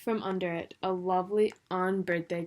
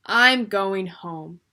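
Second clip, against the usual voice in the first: tired resolve; 11 dB louder, much slower than usual. A narrator's reading. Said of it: "tired of the strange ways".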